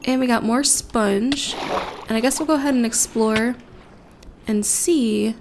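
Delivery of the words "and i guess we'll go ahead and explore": usual speaking voice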